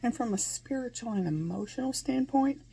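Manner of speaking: usual speaking voice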